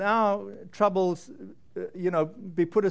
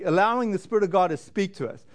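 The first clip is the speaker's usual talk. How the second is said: usual speaking voice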